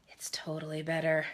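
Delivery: sighing, then a whispered grudging admission; whispered, almost to herself. Retold or imitated. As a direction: breathe it out in a reluctant whisper. Grudgingly admitting edits work.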